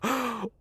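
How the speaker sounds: sharp high shocked gasp. Retold or imitated. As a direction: gasp in disbelief. Friend reacts in shock.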